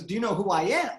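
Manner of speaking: ordinary talk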